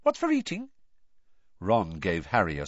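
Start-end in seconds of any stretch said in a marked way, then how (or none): 0.0-0.7 very high, flustered babble; squeaky, muddled small talk; unnaturally high voice. A narrator's reading. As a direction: babble nervously far above normal pitch. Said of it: "in the same unnaturally high voice".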